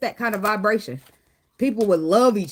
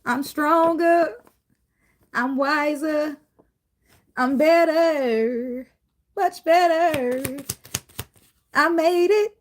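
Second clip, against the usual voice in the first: high, slow, drawn-out declaration; slow high gospel testimony; 7 semitones higher, much slower than usual. Retold or imitated. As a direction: testify slowly and proudly. Voicing grateful person's testimony.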